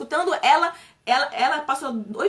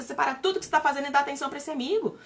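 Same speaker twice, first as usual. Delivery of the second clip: usual speaking voice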